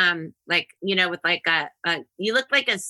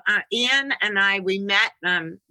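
ordinary delivery

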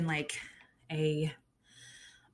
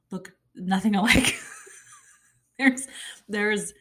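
laughing; 4 dB louder than usual. Real, not acted. Laughing at cousin resemblance.

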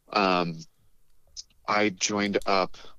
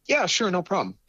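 eager yes; 10 semitones higher than usual. Retold or imitated. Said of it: "And so again".

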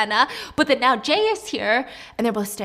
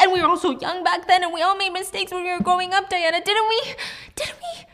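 desperate pleading; 10 semitones higher, 5 dB louder than usual. Acted. Mina begs Diana for forgiveness.

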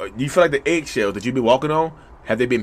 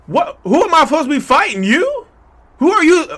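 much higher and louder disbelief; indignant disbelief; 12 semitones higher, 6 dB louder than usual. Acted. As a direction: fire back, outraged and baffled. Indignant answer to the narcissist.

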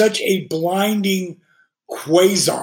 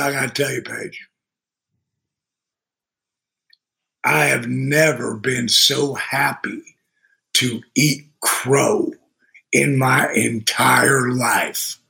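lower, slow sincere confession; heartfelt admission; 6 semitones lower, slower than usual. Retold or imitated. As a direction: confess it gravely and slowly, meaning it. Michael's heartfelt phone confession.

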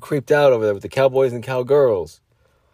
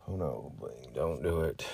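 low, soft pleading to himself; hushed mock panic; 7 semitones lower, 15 dB quieter, slower than usual. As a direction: plead under your breath, low. Acted panicked waking, self-talk.

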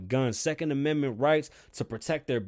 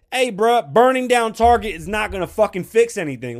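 loud, pitched-up exasperated rant; heated yelling; 6 semitones higher, 7 dB louder than usual. Real, not acted. Ranting to listeners about riots, loud and exasperated. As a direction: yell it like you're fed up.

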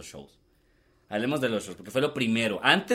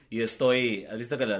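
ordinary delivery

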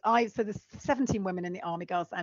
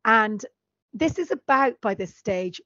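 ordinary delivery

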